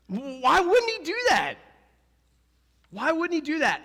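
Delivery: high, loud rhetorical demand; loud challenge. Shown as a demonstration. Preacher pressing the congregation, high and loud. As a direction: fire the question at the crowd. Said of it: "Ask yourself that".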